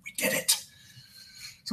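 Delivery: unvoiced proud little whisper; whispered aside. Real, not acted. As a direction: breathe it out like a secret victory. Murder capital joke, whispered aside.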